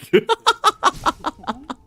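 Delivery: rapid staccato high laughter; helpless high-pitched giggling; high cackling laugh. Real, not acted. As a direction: burst into a high, stuttering laugh. Amused by stomach noises.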